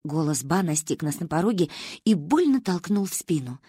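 ordinary delivery

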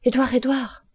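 urgent, frightened shout; anguished cry. A narrator's reading. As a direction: call for the child in anguish. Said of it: "prononcé avec un tel accent d'angoisse".

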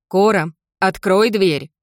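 calling out. Acted. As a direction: call firmly through a locked door. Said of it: "снова попыталась дозваться". (громко зовёт)

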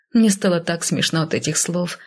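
ordinary delivery

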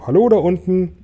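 high-pitched hail; calling down. A narrator's reading. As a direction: greet people far below. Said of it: "er lachte und winkte und rief". (nach unten rufen)

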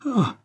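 short high cry of shock; high-pitched gasped exclamation; startled cry. A narrator's reading. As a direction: let out a sudden high "Ah". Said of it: "jeta un cri".

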